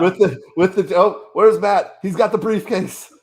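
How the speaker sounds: high, loud, mounting play-by-play excitement; hammed-up ringside shouting; mock wrestling commentator. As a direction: call it like a shock ringside entrance. Mock wrestling cash-in call.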